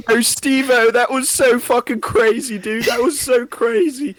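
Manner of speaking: very high, loud squeaky fan voice. Pretend.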